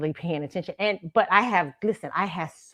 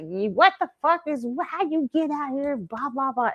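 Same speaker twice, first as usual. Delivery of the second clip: higher-pitched mock scolding; imitating mad boyfriend; 7 semitones higher than usual. Boyfriend mad at her for being drunk, mock angry. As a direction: scold like a fed-up boyfriend, trailing into blahs. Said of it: "he's mad at me".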